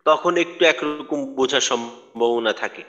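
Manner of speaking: usual speaking voice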